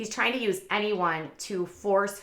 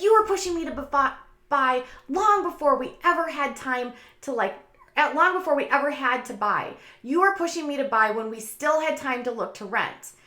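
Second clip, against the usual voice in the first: mimicking the wife, pitched higher, pleading; Robin's complaint; 6 semitones higher, 3 dB louder than usual. Wife protesting to her husband that he pushed her to buy, in a higher imitating voice. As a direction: play back the wife's protest in a higher voice.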